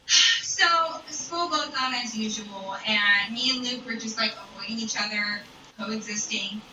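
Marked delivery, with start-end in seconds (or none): none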